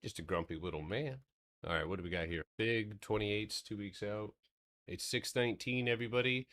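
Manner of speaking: normal talking voice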